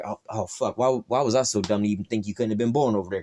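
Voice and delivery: ordinary talk, nothing marked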